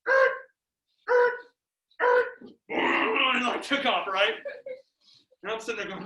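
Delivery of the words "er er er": short, separate screeches; imitated car sounds. Storyteller imitating the car down the road, short screeches. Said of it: "They went".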